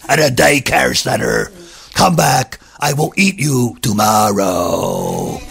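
low, slow dragon voice; deep grumpy dragon turning her away; gruff dragon. Acted. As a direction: growl it out like a big lazy dragon. Dragon voiced in a told story.

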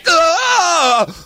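startled high shout; father yelling. Acted. Father wakes with the hamster in his bed and shouts for Jack. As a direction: shout in alarm, woken by the hamster. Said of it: "His father yelling like this".

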